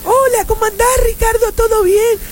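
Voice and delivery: high-pitched imitation of a woman friend; female friend. Woman friend greeting Ricardo on meeting him, in an imitated high voice. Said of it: "encontré una amiga mía".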